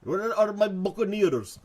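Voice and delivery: high, slow Russian pirate call. Acted. Russian pirate looking for buccaneers, hammed-up accent. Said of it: "How would a Russian pirate sound?".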